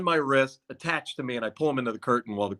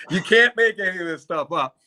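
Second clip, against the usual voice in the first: amused disbelief, laughing; 9 semitones higher, 3 dB louder than usual. Real, not acted. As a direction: still laughing, words riding high. Laughing after absurd story claim.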